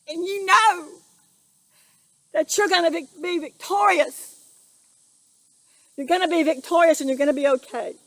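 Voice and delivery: rally them to the fight; rousing preaching. Real, not acted. Exhorts viewers like a preacher.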